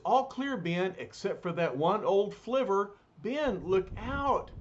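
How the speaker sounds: warning call. Acted. Reed warning Ben as he lands, high shout.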